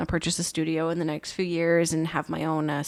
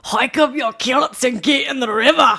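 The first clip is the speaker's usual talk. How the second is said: hammed-up Scottish, higher and louder; loud mock-Scottish holler with laughter in it; Scottish accent; 5 semitones higher, 5 dB louder than usual. Shown as a demonstration. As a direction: belt out a comic Scottish accent. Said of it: "like, a Scottish accent".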